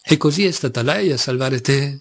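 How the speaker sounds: usual speaking voice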